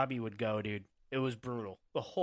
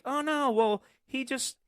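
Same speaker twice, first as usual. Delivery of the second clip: much higher, whiny mock defense; mocking Boston media; 11 semitones higher, 5 dB louder than usual. Mocking Boston reporters to a friend, high and whiny. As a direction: mimic a fawning Boston pundit. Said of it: "Boston, it's like".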